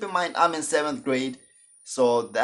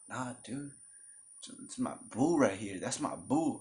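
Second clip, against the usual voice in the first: playing it cool; 10 dB quieter than usual. Cousin introducing his girlfriend, hushed and cool. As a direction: quietly play it off. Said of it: "he's trying to play it cool".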